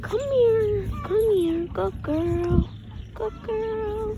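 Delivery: high, drawn-out animal-calling voice; coaxing an animal. Real, not acted. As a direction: sing out high to coax the animal over.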